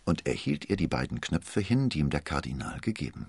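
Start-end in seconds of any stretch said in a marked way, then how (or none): none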